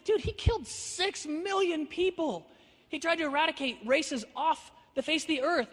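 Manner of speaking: high-pitched, drawn-out disbelief; slow, high exasperated retort; incredulous protest. Retold or imitated. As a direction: argue it back in astonishment. Incredulous retort in retold argument.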